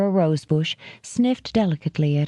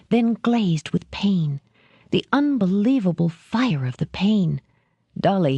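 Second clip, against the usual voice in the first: ordinary delivery, nothing marked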